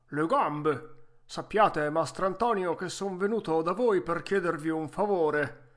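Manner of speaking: vecchietto arzillo, voce forte e più alta; Geppetto arzillo. Acted. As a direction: saluta forte, da vecchietto pimpante. (vecchietto arzillo a voce alta)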